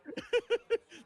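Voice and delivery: high burst of real laughter; loud laugh. Real, not acted. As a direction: crack up loudly. Laughing at friend's rant.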